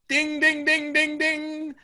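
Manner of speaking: sung ding ding of the pub bell; bell ding imitation. Teller imitating the pub's closing bell. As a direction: ring it out like a bell.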